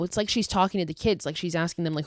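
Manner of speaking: ordinary talk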